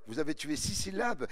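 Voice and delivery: ordinary delivery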